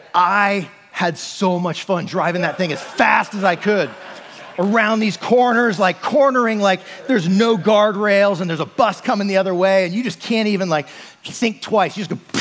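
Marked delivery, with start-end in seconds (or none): none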